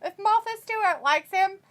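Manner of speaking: high, posh-pretend old lady, laughing at the end; mock Queen voice. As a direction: ham up the Queen approving of a friend. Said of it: "And she said".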